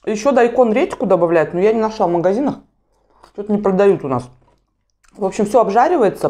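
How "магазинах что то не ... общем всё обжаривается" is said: chewing while talking; speaking between bites; mouth full of noodles. Real, not acted. Seen 2: Bite taken at -1.0; lips and jaw move as if chewing while speaking.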